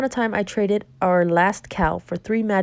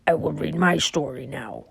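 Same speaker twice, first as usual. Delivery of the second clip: 1 low giant voice; 3 semitones lower than usual. Acted. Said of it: "said the giant".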